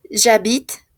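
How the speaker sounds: j'habite ending sharply on the t sound; silent final e. Shown as a demonstration. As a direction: leave the e of j'habite unsaid. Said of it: "no se pronuncia".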